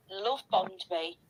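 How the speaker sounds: ordinary talk, nothing marked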